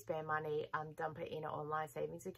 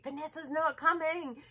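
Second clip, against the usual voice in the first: higher, louder imitation of a stranger's yell; mock street yell; 9 semitones higher, 5 dB louder than usual. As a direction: call it out like someone in the street. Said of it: "someone yelling in the street".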